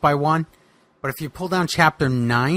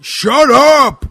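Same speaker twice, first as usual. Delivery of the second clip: higher, louder snapped shut up; mock angry shout, pitched up; 9 semitones higher, 9 dB louder than usual. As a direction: bark it at the pest. Boogeyman yells at the ghost.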